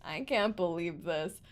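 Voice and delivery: low, soft and deflated; worn-out disbelief. Real, not acted. Player worn out by cruel game, deflated disbelief. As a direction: say it low, all fight gone.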